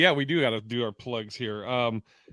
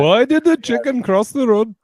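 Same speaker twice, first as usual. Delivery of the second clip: a hammed-up Irish lilt, higher and louder; jokey stage-Irish accent, pitched up; bad Irish accent; 9 semitones higher, 7 dB louder than usual. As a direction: tell the old joke in a poor fake Irish accent. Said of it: "It was my Irish".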